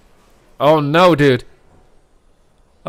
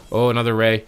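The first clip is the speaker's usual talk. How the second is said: usual speaking voice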